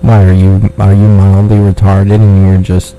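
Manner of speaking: usual speaking voice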